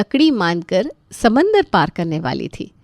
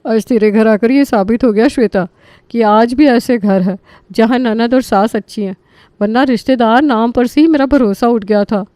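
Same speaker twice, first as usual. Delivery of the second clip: admiring friend; 3 semitones higher, 5 dB louder than usual. Acted. Friend praises Shweta's kind in-laws, loud and gushing. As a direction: praise the hosts with gushing warmth.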